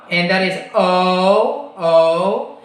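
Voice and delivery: slow "o" gliding into u; drawn-out British "o"; diphthong demo. Shown as a demonstration. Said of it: "combination of the o and the u".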